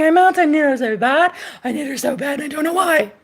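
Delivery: high, whining mock voice of a desperate man; mock whiny man. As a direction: whine it like a pathetic guy begging. Mocking a needy man's whine.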